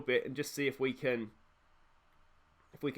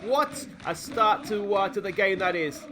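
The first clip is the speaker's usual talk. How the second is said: savouring delight; 4 semitones higher, 4 dB louder than usual. Real, not acted. After early goal, savouring.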